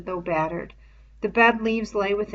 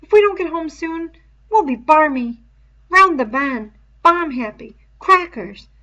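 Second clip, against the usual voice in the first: put-on higher, louder anxious boy; Piggy's voice; 9 semitones higher, 11 dB louder than usual. A narrator's reading. Piggy warning Ralph they'll go mad, higher boy voice. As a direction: voice a worried fussy schoolboy.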